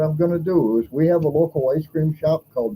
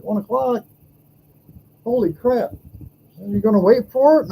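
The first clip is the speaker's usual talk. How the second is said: retold disbelief; 7 semitones higher than usual.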